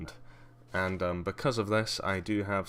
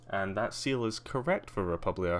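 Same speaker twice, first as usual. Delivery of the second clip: ordinary talk, nothing marked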